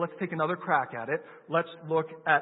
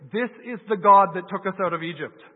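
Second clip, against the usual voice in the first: loud proclamation; 4 semitones higher, 11 dB louder than usual. Retold or imitated. Preacher quoting the Israelites to the congregation, loud proclamation. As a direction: proclaim it loudly to the crowd. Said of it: "They say".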